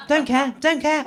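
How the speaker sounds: laughing, sing-song don't care; mock dismissive. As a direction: toss it off with a giggle. Mock chant of indifference.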